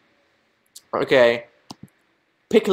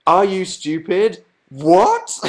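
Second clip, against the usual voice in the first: higher, drawn-out incredulous question; mock outrage, ending on a raised what; imitated disbelief; 5 semitones higher, 4 dB louder, much slower than usual. Reading out the chat's insults, higher mock disbelief.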